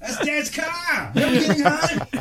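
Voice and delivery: put-on a six-year-old freaking out over dad's car; mock kid panic, high and wailing; panicked kid. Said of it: "I'm like, freaking out".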